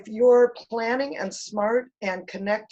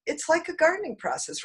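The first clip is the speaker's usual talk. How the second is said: ordinary delivery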